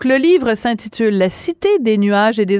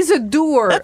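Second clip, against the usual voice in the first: "doer" said in English as "doo-er", a playful English aside; English pun line; 4 semitones higher, slower than usual. Pretend. Host joking about the author's name, light English accent. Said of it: "On va prononcer comme ça".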